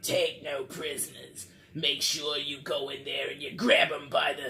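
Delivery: put-on demon laying down ruthless orders; demonic voice. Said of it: "like, this demonic voice".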